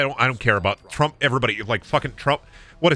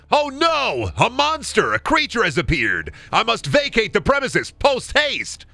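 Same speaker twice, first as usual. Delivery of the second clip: high, hammed-up panic; cartoon fright; 9 semitones higher than usual. Factory worker fleeing a monster, hammed-up fright. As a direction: panic like an old cartoon victim. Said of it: "can you ad-lib a Scooby-Doo episode".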